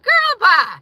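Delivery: loud, high brush-off; dismissive. Real, not acted. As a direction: wave them off hard. Dismissive retort, shouted.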